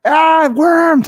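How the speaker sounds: very high, loud shriek of fake horror; horrified mock scream. Kid pours pancit from the thermos, hammed-up horrified scream. Retold childhood fright at lunch.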